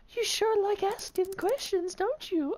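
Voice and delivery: creepy high falsetto, slow; slow high creepy lady; man doing a woman's voice. Pretend. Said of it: "such a bad girl's voice".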